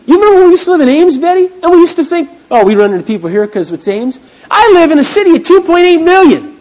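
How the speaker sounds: high, loud and fast; excited preaching. Acted. Pastor asking his wife Betty, excited preaching. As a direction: rattle off the story to the crowd.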